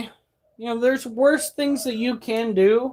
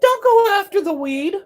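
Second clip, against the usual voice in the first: much higher and louder, pleading at them; high, loud demand; 13 semitones higher, 6 dB louder than usual. Real, not acted. Vlogger ranting at politicians, high loud demand. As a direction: call it out high and loud, pushing the point.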